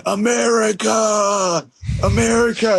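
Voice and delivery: high, slow imitated holler; retold screaming. Driver in a passing Escalade, imitated patriotic holler. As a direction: holler it out like a guy leaning from a passing car. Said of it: "honking the horn and screaming".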